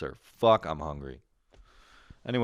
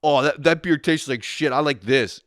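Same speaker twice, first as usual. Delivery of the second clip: high, loud hammed-up whine; mocking whiny friend; 6 semitones higher, 7 dB louder than usual. Host parodies his friend dissing beer, loud mocking whine. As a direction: sneer it as a spoiled brat.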